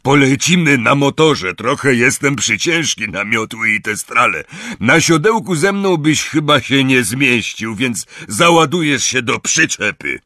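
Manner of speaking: booming, good-natured explanation; big friendly giant explaining. Acted. Hagrid explains the ride.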